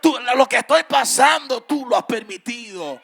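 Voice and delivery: loud, rising sermon cry with a sung lilt; full-force preaching. Acted. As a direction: preach it out over the music to the congregation.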